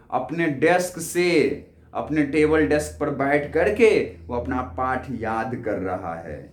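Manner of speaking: ordinary talk, nothing marked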